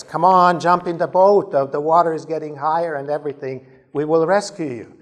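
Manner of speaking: loud, high urging shout; rescuer's insistent call. Acted. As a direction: holler to a stranded man from a boat. Second boatman calls out.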